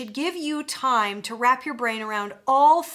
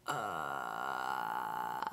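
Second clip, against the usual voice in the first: low creaking door sound; vocal fry creak; 13 dB quieter than usual. Shown as a demonstration. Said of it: "it's not a pretty sound".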